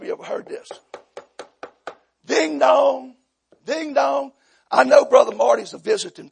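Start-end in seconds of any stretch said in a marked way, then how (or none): 2.2-4.3 sing-song ding dong; drawn-out, higher doorbell chime; imitated doorbell. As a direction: mimic a doorbell.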